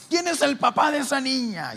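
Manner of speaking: furious mother yelling for the father; angry demand. Retold or imitated. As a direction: demand it of the whole street.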